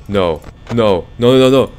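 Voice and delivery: real dread as the monsters close in; rising panic. Real, not acted. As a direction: panic building to a loud cry. Monsters closing in on him.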